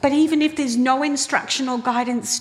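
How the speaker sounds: usual speaking voice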